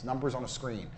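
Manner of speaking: usual speaking voice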